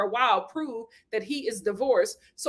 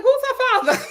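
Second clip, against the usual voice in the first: higher, laughing mock question; imitating mom; 11 semitones higher, 6 dB louder than usual. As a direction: voice mom asking, amused. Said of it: "She was just like".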